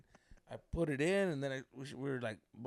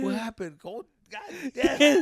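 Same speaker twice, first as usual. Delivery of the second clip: mock exasperation; 11 semitones higher, 14 dB louder, slower than usual. Retold frustration at quick finish.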